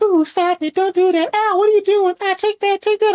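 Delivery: very high falsetto mock fighting woman; high-pitched Katana voice. Player imitating an opponent reacting to hits, high-pitched Katana voice. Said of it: "in, like, a high-pitched Katana voice".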